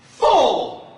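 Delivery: high, loud disbelieving cry; incredulous exclamation. Real, not acted. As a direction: blurt it out in mock shock. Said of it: "exclaiming in disbelief".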